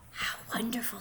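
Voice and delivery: hushed, breathless whisper of wonder; amazed whisper from the window; awed whisper. A narrator's reading. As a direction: whisper so the witch won't hear. Boy secretly watching the magic.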